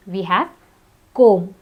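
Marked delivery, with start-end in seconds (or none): 1.1-1.6 comb ending on M, no B; silent B. Shown as a demonstration. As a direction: say comb as kome. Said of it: "In the same pattern".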